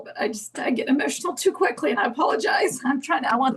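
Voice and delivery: holding back tears, voice catching on the apology; fighting tears while apologizing; real tearfulness breaking in; tearful. Real, not acted. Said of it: "When, when you got, uh, tear-, tearful".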